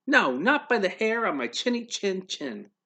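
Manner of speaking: high squeaky little pig refusing. Acted.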